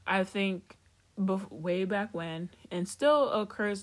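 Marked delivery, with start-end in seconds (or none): none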